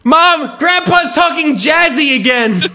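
high, whiny call across the house; kid calling Mom. Pretend. Child telling Mom about grandpa, mock complaining shout.

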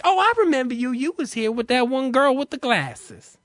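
high-pitched effeminate clerk recognising a customer; feminine male clerk. Retold or imitated. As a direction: chirp it in a campy put-on voice. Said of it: "He's very feminine sounding".

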